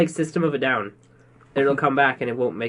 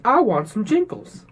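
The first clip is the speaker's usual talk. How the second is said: high, slow, over-proper request; mock formal; 8 semitones higher than usual. Co-host teasing a failed Boston accent, mock formal. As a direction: imitate it stiffly, pitched up. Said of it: "Just really formal there".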